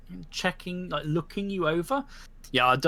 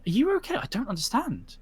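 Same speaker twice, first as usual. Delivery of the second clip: worried question; 8 semitones higher than usual. Acted.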